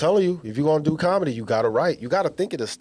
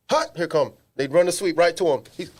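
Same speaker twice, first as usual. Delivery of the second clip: ordinary talk, nothing marked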